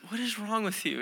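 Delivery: imitated hurt twelve-year-old. Twelve-year-old questioning mom after the prank, mildly hurt imitation. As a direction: softly play an affronted child. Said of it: "when a twelve-year-old looks at you and says".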